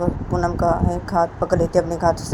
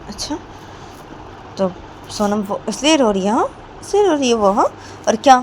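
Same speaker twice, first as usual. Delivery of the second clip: worked-up, sarcastic scolding with punched words; lightly acted indignant mother figure; indignant Poonam; 4 semitones higher, 3 dB louder than usual. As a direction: egg her on crossly, tossing in challenging questions. Plays Poonam goading Payal about the child.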